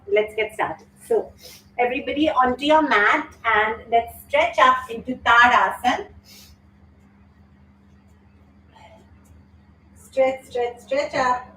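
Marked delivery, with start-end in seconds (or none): 1.8-11.5 bright, higher teacher voice calling out poses; energetic yoga class cues; yoga instructor cueing. Acted. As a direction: lead the class into the stretch.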